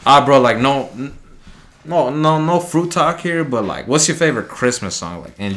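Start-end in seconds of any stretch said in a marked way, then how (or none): none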